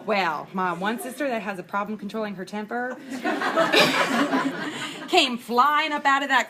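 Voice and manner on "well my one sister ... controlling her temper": usual speaking voice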